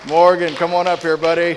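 loud friendly call. Real, not acted. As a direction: call him up cheerfully. Calling winner up front.

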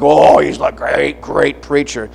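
mock British or Australian accent; imitated British accent. Americans admiring a visiting British preacher, mock British accent. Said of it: "they got that British accent or that Australian accent".

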